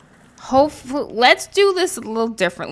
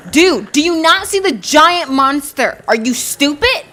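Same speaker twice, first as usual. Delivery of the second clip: loud, raised-pitch exasperated shouting; annoyed yelling; 4 semitones higher, 4 dB louder than usual. Real, not acted. Player yelling at teammates who shoot him, annoyed. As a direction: yell in indignant disbelief. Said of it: "I'm getting so annoyed right now".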